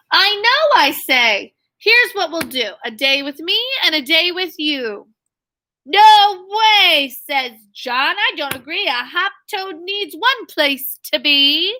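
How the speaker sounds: lively, sing-song storybook read; bouncy picture-book reading voice; reading to children. A narrator's reading. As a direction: read the rhyme aloud to young kids. Teacher reading picture book aloud.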